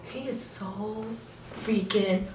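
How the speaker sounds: very soft, hushed inner thought; awed whisper. Retold or imitated. Fan with idol leaning close, awed hushed thought. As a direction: breathe it out, barely audible.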